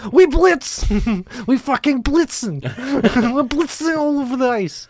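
put-on high German-accented battle cry; excited mock German accent; German Blitzen. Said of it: "Sounds German".

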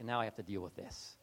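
low, soft resigned grumble; weary Jesus. Retold or imitated. Jesus back from the mountain, low weary grumble. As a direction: sigh it out like a chore.

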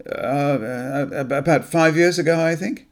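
a frightened man barely holding his lie; trembling. Acted. Graham trembling under Anne's questioning, nervous stammer. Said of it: "He felt himself begin to tremble".

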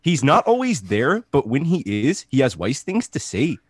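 bouncy, exaggerated Canadian accent. Host performs the Canadian fan's review in a Canadian accent. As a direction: put on a Canadian voice for the letter. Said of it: "I should be reading this in a fucking Canadian accent".

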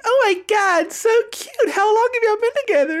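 very high, fast, gushing chatter. Retold or imitated. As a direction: coo excitedly at the couple. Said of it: "she's like".

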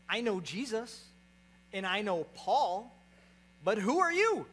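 builds to a high, loud challenge; demon's reply, slowing then bursting out high and loud. Acted. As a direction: play a demon taunting, rising to a shout. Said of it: "what the demon responds".

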